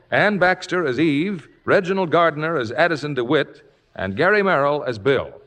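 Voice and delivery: ordinary talk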